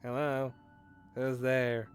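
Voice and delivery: ordinary talk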